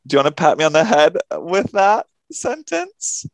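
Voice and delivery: high, teasing mock offense; mock offended. Real, not acted. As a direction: play hurt, teasingly.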